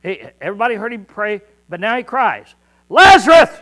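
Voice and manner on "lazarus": calling a dead man out of the grave at full voice; loud cry. Acted. Jesus at the tomb, shouting to Lazarus. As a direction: shout the name to wake the dead. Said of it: "he cried with a loud voice".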